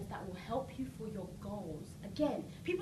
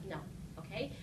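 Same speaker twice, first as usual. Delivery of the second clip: ordinary delivery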